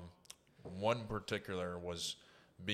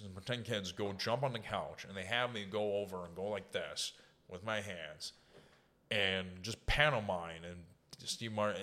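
ordinary delivery

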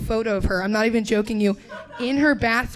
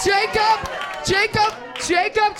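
calling out; 9 semitones higher, slower than usual. Real, not acted. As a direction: shout the name to the back rows. Polling crowd, calling out.

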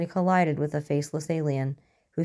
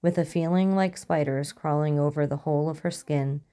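ordinary delivery

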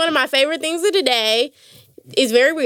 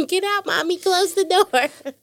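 imitated little child; 5 semitones higher, slower than usual. Mother voices child wanting privacy.

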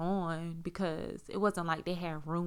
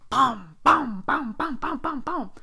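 high, punchy repeated booms; raised-pitch thudding sound words; imitated banging; 7 semitones higher, 7 dB louder, slower than usual. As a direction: act out blow after blow. Imitates repeated blows to head.

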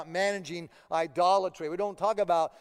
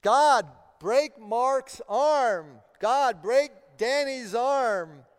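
slow, loud, higher, half-joking plea for vengeance; mock fierce prayer; 6 semitones higher, 8 dB louder, much slower than usual. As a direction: ham up a vengeful prayer, dragging each word. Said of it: "You, you ever prayed that way?".

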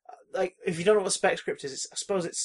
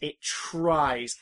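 "tries" drawn out with a falling tune; "tries" stretched as a pointed qualification; stress on tries. Real, not acted. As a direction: lean long and hard on "tries". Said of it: "Emphasis on tries".

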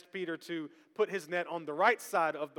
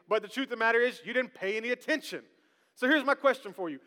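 usual speaking voice

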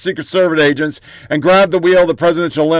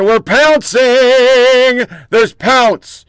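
slow, higher and louder sneering cry of "We're pouncing!"; mock pouncing; 6 semitones higher, 3 dB louder, much slower than usual. Host parodying right-wing media, hammed-up slow gloat. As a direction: ham up the villain gloating.

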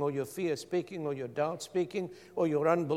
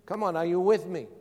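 ordinary talk

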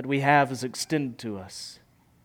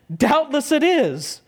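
high, loud and eager, tumbling out; the soul bursting with glad wonder; joyful exclaiming; 8 semitones higher, 5 dB louder than usual. Retold or imitated. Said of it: "My soul said".

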